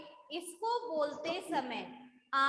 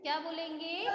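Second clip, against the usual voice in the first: normal talking voice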